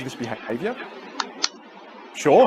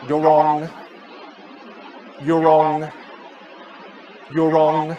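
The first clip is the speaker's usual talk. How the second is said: monotone chime on one held note; annoying clock; much slower than usual. Shown as a demonstration. Coach tells students they're wrong, repeating like a clock. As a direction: intone it evenly like a clock striking. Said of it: "Like one of those annoying clocks".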